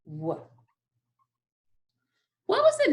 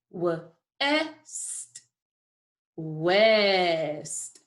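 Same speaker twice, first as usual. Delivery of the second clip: stretched out word; 4 semitones lower than usual. Shown as a demonstration. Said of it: "What sounds do you hear in west?".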